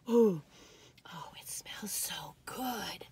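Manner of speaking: slow, soft whisper; hushed, slow delight; savoring whisper. Real, not acted. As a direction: breathe in the smell and sigh it out. Savoring the cookie smell.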